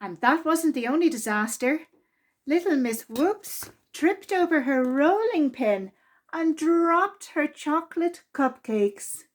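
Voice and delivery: plain reading voice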